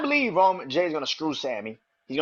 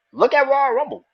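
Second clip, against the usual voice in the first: ordinary talk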